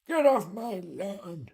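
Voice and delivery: put-on slow cranky old man shout. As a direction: drag it out like an angry old man. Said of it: "That's my old man voice".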